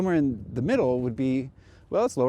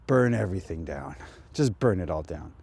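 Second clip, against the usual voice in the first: inner voice; 7 semitones lower than usual. Retold or imitated. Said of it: "a voice in our head during these times that just says".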